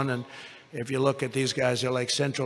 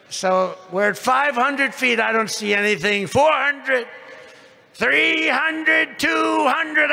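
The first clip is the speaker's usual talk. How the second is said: rising higher and louder to a shout; building excitement; 8 semitones higher, 3 dB louder, slower than usual. Real, not acted. Telling the landing story, building to a shout. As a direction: count down with mounting alarm.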